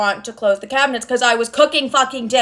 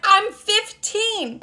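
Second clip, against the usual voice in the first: much higher, slow, whiny teen imitation; whiny protest; 9 semitones higher, 3 dB quieter, much slower than usual. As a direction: stretch it out like an indignant kid. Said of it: "I'm like".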